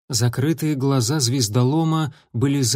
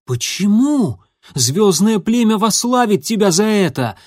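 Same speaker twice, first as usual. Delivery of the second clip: higher, louder and incredulous; disbelieving shout; 7 semitones higher, 5 dB louder than usual. A narrator's reading. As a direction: cry out in disbelief. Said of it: "крикнул Огнегриф".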